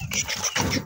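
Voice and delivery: soft unvoiced chu-chu to calm; whispered calming clicks; soothing shushing sounds. Real, not acted. Calming the raccoon.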